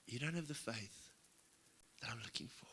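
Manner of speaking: a hint of a calm divine voice; voice of the Lord. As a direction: speak as God, quietly correcting. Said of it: "And he says".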